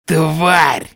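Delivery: hoarse growl. A narrator's reading. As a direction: wheeze it out like a beaten brute. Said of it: "прохрипел быкоподобный Дарган".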